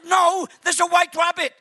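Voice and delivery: loud, high, insistent; insistent yell. Retold or imitated. Preacher retells insisting to reception, loud and high. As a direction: insist over the phone.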